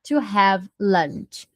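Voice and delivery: slow, clear teacher model line; slow classroom demonstration; slow model for repetition. Shown as a demonstration. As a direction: spell it out slowly for students to copy.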